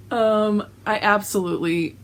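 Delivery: ordinary talk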